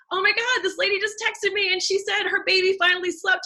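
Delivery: high-pitched, thrilled retelling; excited geeking out. Acted. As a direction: share big news with friends in a high voice. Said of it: "geek out about it".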